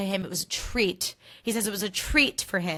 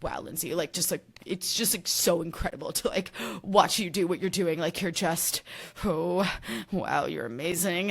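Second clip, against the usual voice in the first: fawning Carl. Retold or imitated. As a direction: gush at her like a smitten fan.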